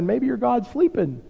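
high, sing-song mock of the prophets; mocking taunt. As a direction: taunt them that their god is asleep. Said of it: "he's almost, like, mocking them".

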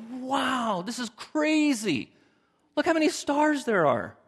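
high, slow, breathless awe; awed wonder. Retold or imitated. Someone camping, amazed at the stars, high and awed. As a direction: gaze up stunned by the stars.

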